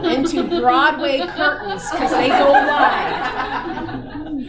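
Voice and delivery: giggling out the punchline, drawn out; wide stretched slow through laughter; laughing. Real, not acted.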